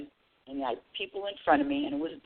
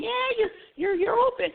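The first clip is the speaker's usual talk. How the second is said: much higher, thrilled squeal; excited screaming. Retold or imitated. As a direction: squeal down the phone at her friend. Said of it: "we're both screaming on the phone".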